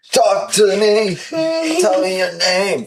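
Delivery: high, slow coaxing; high sing-song voice to the baby; baby talk. Pretend. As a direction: coax the toddler, high and slow.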